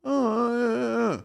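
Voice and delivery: high, slow, hammed-up stammer; mock stammering. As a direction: mimic hosts lost for words. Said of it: "and they go".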